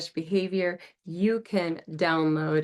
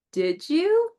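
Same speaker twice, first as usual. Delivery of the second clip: much higher, lifted question; teacher asking the class to self-check; high questioning prompt; 10 semitones higher, 4 dB louder than usual. Shown as a demonstration. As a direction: toss the question up to the kids. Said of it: "And then you say".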